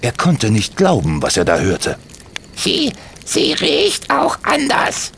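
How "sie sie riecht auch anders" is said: put-on very high child's voice, halting repeats; a guilty kid stumbling over words; stammering boy.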